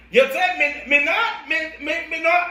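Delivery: imitating Paula's mom in patois; Jamaican patois. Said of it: "Jamaicans ... speak the patois".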